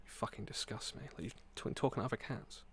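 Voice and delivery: the jealous cat whispering at its owner after other cats; disgusted cat whisper. Pretend. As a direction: hiss the betrayal under the breath. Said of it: "walks away like".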